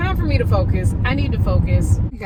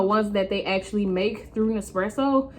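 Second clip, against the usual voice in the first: ordinary talk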